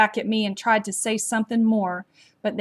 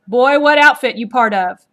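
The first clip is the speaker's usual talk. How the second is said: loud harsh demand; barked demand; 3 semitones higher, 10 dB louder than usual. A narrator's reading. Leader interrogating the captured boy, loud barked demand. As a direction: bark it like an officer. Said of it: "their leader barked".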